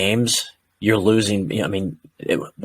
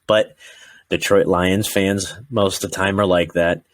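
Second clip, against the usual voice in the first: ordinary talk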